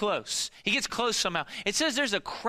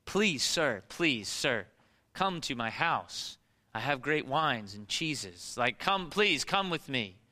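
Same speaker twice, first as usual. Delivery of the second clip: mock polite invitation; 6 semitones lower, slower than usual. Pastor mocking Jairus as a calm host inviting Jesus over, leisurely and polite. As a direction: play it as an unhurried, courteous invite with no urgency. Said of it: "It wasn't like that at all".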